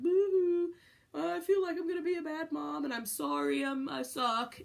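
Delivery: high, hammed-up boo-hoo whine; a mock crybaby lament, high and a bit loud; mock whining sob. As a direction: whine it like a self-pitying child, half joking. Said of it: "just be like".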